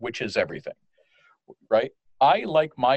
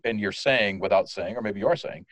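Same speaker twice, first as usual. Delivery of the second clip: usual speaking voice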